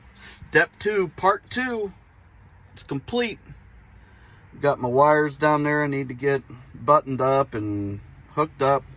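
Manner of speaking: normal talking voice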